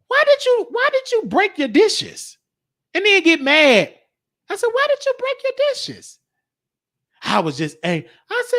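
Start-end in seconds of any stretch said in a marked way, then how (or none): 0.1-6.2 high-pitched pleading question; high kid question. Retold or imitated. Kid asking mama why she broke dishes, high. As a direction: ask it high like a bewildered child.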